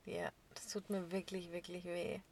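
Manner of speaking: softly whispered pity; hushed mock condolence. Real, not acted. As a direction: whisper your sympathy. Mock sympathy.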